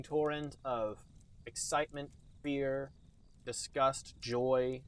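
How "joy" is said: ordinary talk, nothing marked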